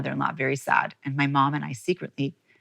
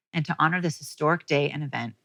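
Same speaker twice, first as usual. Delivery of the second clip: ordinary talk, nothing marked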